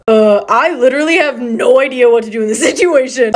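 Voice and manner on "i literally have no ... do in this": ordinary delivery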